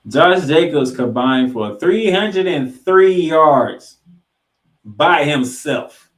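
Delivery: loud, slow, amazed stat call; amazed emphasis. Real, not acted. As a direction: hammer home the huge number. Marvelling at the stat.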